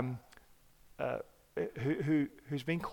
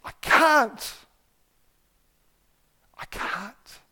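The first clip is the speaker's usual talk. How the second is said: tearful despair; 9 semitones higher, 5 dB louder, much slower than usual. Retold or imitated. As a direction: act out a helpless friend's cry. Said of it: "he's in tears".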